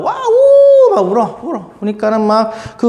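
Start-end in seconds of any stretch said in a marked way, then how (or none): 0.0-0.9 high, loud mock horse wail; horse's wailing cry. Teacher voicing the weeping horse to students, high loud mock wail. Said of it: "소리내어 울어요".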